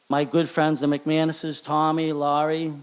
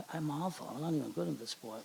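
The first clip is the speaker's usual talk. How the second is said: hushed self-doubt, under the breath; inner thought; 12 dB quieter than usual. Retold or imitated. Player doubting himself mid-game, hushed inner voice. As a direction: mutter it to yourself, defeated.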